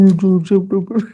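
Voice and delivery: mumbled gibberish imitation. Mocking why people couldn't understand the singer, garbled babble. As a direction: garble nonsense syllables like an unintelligible singer. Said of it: "couldn't understand [name]".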